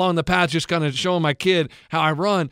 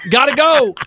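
panicked; 7 semitones higher, 6 dB louder, slower than usual. Retold or imitated. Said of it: "I'm like".

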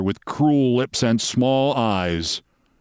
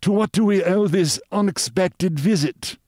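high, silky lounging villain; soft-mannered, high-pitched suave man; soft-voiced; 10 semitones higher than usual. A narrator's reading. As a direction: purr it high, without raising volume. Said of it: "in a soft voice".

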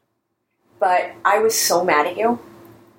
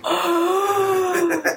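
high, long gasped "oh"; mock-horrified gasp, drawn out high; retold horrified gasp; 9 semitones higher than usual. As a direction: act out a sharp, appalled intake of breath. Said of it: "And I was like".

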